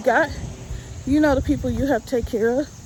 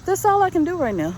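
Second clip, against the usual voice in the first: breathless after the walk; winded, catching breath between phrases; 6 semitones higher than usual. Real, not acted. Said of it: "You see, I'm out of breath".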